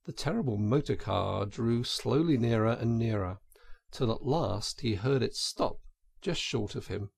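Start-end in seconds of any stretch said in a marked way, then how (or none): none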